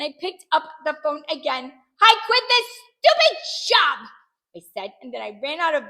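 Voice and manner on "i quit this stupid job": high, loud, fed-up yell; indignant outburst. A narrator's reading. Hurt child quits playing nurse, indignant shouting. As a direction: storm off with a shout.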